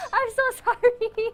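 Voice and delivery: high, loud laughing apology; hyper laughing. Real, not acted. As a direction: giggle through the apology. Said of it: "getting faster and faster".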